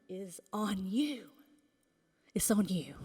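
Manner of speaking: dramatic hushed aside; sudden whisper. Real, not acted. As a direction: drop to a breathy conspiratorial whisper.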